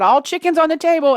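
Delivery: high-pitched mild yell; retold yell. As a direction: call out, imitating a yell. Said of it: "I actually yelled out".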